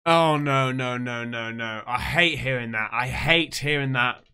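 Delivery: low, pained, sinking voice; real upset, sagging down in pitch; emotional dismay. Real, not acted. Viewer hearing of a murdered baby, low and dismayed. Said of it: "sorry about getting emotional".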